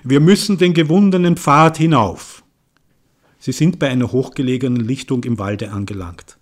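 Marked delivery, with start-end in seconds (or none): 0.0-2.4 Borkmann's voice. Acted. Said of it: "Borkmanns Stimme".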